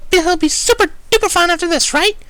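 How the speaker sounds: very high, loud frantic pony; chirpy, with panic underneath; hint of hysteria. A narrator's reading. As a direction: Pinkie pleading cheerfully, edging on hysteria. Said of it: "slightest hinting of hysteria".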